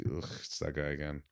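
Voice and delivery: low, very soft groan; muttered distaste. Retold or imitated. Club staff spotting a creepy customer, muttered distaste. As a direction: grumble it under your breath. Retold girls dreading a regular.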